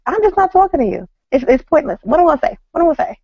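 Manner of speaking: imitating husband. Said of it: "He was like".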